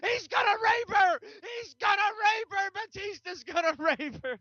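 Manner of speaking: very high, strained yelling; hammed-up high-pitched alarm shout; cousin's mock scream. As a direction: imitate a frantic fan screaming at the referee. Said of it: "my cousin Tom screams out".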